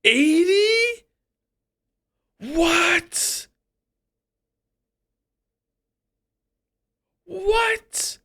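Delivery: very high-pitched shriek of disbelief; real high-pitched shock. Real, not acted. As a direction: squeal it, stunned by the answer. Shocked at the revealed year.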